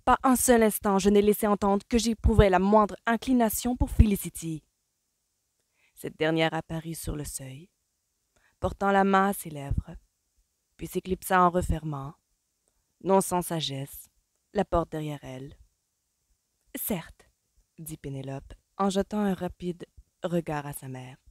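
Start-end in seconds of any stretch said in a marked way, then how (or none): 0.1-4.6 loud, higher indignant insistence; outraged, raised voice; angry protest. A narrator's reading. Colin angrily protests. 16.8-17.3 soft concession. A narrator's reading. Penelope concedes softly.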